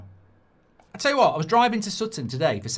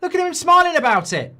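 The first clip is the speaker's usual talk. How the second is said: loud, higher outraged yell; furious shout; 9 semitones higher, 8 dB louder than usual. Real, not acted. Fuming at the official on camera.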